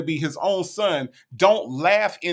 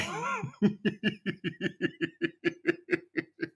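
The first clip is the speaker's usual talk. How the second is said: long, soft wheezing laugh; wheezing laughter; 8 dB quieter than usual. Real, not acted. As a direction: wheeze with laughter until out of air. Laughs at what was said.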